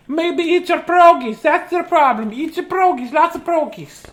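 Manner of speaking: high, loud grandma voice urging food; a hint of a pushy babushka offering pierogies. A babushka in a hat telling Miss Christie to eat pierogies, high and loud. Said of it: "my hat makes me look like a babushka".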